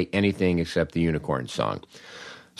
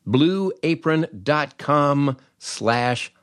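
upbeat, higher-pitched sales pitch; ad read; 4 semitones higher than usual. Acted. Host pitching Blue Apron to his listeners, upbeat ad read. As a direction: sell it hard to the listeners, like a radio spot.

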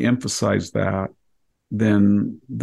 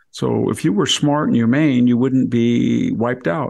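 ordinary delivery

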